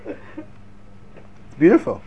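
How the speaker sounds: slow, breathy ironic awe; whispered mock wonder; mock admiration. Real, not acted. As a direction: savour it ironically.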